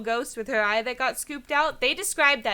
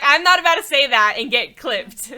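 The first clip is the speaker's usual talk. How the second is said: loud, insistent no; flatly refuse, raising the volume; loud laughing protest; emphatic refusal; 9 dB louder than usual. Real, not acted. Refusing to read crude line.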